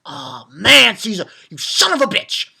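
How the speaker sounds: loud, exasperated cry at a villain; angry outburst. Retold or imitated. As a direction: blurt it out at the villain. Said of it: "and I was like".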